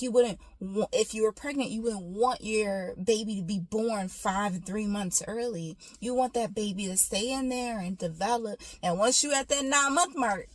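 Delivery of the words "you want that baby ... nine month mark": usual speaking voice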